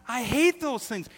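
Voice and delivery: high, loud exasperated outburst. Real, not acted. Pastor ranting about curtain doodads, loud and exasperated. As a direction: blow up over the curtain hardware. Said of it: "hates curtain doodads".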